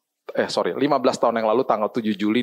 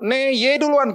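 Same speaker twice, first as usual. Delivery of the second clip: a higher, teasing "no, you first"; mother's playful insisting; 14 semitones higher, 3 dB louder, slower than usual. Retold or imitated. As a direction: coax him gently to go first, imitating mom. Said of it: "Mama gitu".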